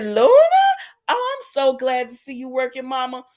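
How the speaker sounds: high, gushing welcome; delighted greeting. Retold or imitated. Acts out greeting a favourite actress.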